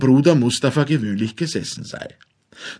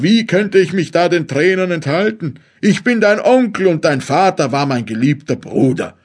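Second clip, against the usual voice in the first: louder, higher emotional outcry; called out; 5 semitones higher, 4 dB louder than usual. A narrator's reading. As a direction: raise your voice in an understated lament. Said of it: "rief der afrikanische Zauberer". (bewegt ausgerufen)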